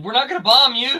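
high, loud joking reassurance; mock calling out. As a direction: pretend to holler it across to another car. Joking line to call to Ron.

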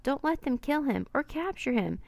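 high, anxious pleading; lightly acted tearful voice; mild sobbed plea. A narrator's reading. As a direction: beg with rising distress, no real sobs. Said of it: "she sobbed".

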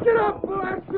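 high, urgent holler at the team; exasperated driving shout. Acted. Driver whose team is being slowed, shouting exasperatedly. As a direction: curse the horses onward.

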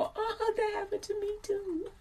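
high, soft, knowing squeak; imitating a listener. Listener recognizing herself, high soft mock agreement. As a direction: play a listener who relates, high and hushed. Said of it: "will make you, like, go".

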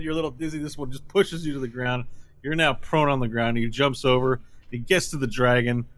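ordinary talk

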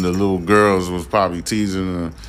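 ordinary talk

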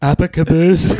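breaking into laughter mid-impression; laughing through a Trump voice; Trump accent, laughing. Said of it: "speak a little in Trump's accent".

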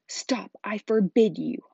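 loud, desperate yell; panicked Uncle Vernon. A narrator's reading. Uncle forbids the secret being told, panicked yell. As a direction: yell it to cut him off in panic. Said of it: "yelled Uncle Vernon in panic".

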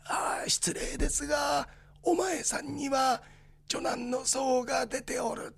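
put-on slow, quiet old fortune-teller voice; a soft, slow old man's warning; Hideji Otaki impression. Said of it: "寅さんとすれ違った時に".

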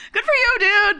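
high cheer breaking into laughter; laughing. Retold or imitated. Girls cheering a guy in line, laughing high. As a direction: cheer him on, cracking up. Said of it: "And we were like".

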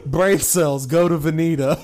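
shouted through laughter; loud laughing command. Pretend. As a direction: yell it while cracking up. Laughing command to brain cells.

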